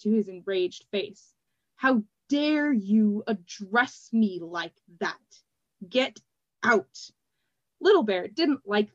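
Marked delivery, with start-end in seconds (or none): 1.8-7.1 slow, stiff outrage; measured fury ordering her out; slow angry order. A narrator's reading. Glassblower throwing Triss out, slow fury.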